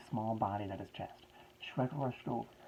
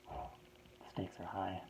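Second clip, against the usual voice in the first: slow, breathy whisper; dramatic whisper; 2 semitones lower, 6 dB quieter, much slower than usual. Pretend. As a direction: whisper it slowly, mock ominous.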